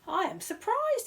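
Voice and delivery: high, loud surprised voice; surprised housekeeper. Acted. Housekeeper exclaims at Paddington's wrong answer, high. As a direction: exclaim in disbelief. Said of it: "said Missus Bird".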